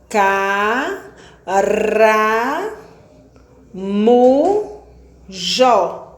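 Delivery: "Pé, te, ca" sílabas destacadas, com pausas; silabando. Shown as a demonstration. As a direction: bata cada sílaba de peteca. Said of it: "mais de quatro sílabas". (separando sílabas para a turma)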